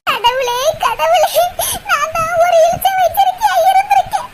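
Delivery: ordinary delivery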